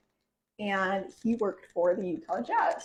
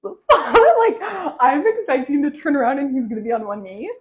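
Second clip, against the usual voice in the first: high laughing disbelief; 6 semitones higher, 8 dB louder than usual. Real, not acted. Girlfriend recalling the non-proposal, laughing loudly. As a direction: laugh through the words. Laughing at her own expectation.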